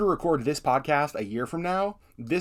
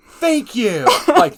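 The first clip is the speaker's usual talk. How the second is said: high, loud burst of thanks; imitated yell; 7 semitones higher, 11 dB louder, much slower than usual. Listeners feeling validated by the podcast, yelling at their device. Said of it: "like, yelling at their device".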